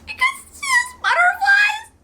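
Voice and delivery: high-pitched, crying over sweet words; tearful squeal. Real, not acted. Reader reacting to a child's butterflies, tearful squeal. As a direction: squeak it out through real tears.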